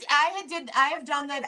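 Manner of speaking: normal talking voice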